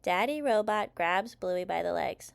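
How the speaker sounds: plain reading voice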